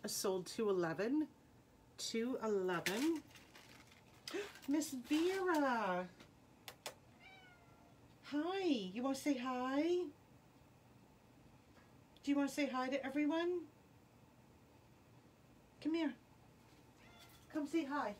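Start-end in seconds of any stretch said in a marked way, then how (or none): 4.3-6.1 happily surprised to see the cat; delighted gasp. Real, not acted. Greets her cat arriving. 8.3-18.1 high, coaxing pet voice; talking to a pet. Real, not acted. Coaxing cat to the camera.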